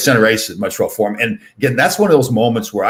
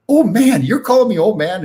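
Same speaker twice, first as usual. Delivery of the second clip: high, loud, disbelieving comeback; mock outrage; 11 semitones higher, 3 dB louder than usual. To Larry Robinson, who called him old man: high disbelieving retort. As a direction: squeal it back in playful disbelief.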